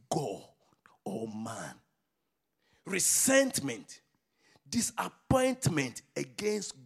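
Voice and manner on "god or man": sudden hushed whisper; whispered aside to the congregation. Acted. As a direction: drop to a breathy whisper for effect. Preacher drops to whisper.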